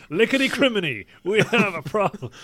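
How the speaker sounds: hammed-up stage Irish accent; Irish version of the NASA line in a mock Irish accent. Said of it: "imagine what the Irish version of".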